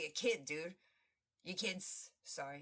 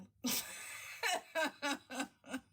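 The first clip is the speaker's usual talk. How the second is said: big laugh; 4 semitones higher than usual. Real, not acted. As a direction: burst out laughing. Laughing at the lyrics.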